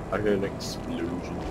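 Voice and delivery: ordinary delivery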